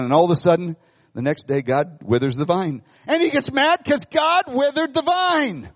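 usual speaking voice

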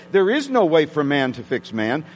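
ordinary talk, nothing marked

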